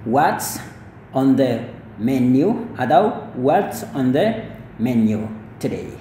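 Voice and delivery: "What's on the menu" drawn out slowly, each word clear; slow it right down for learners; slow model sentence. Shown as a demonstration. Teacher repeating the sentence for students, slowly. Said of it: "Jadi kayak gitu".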